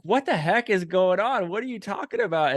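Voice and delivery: baffled. Retold or imitated. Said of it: "And he's like".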